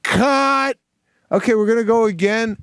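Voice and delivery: high, brisk set call; businesslike director's shout, imitated. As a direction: call cut and move straight on. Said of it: "And I get".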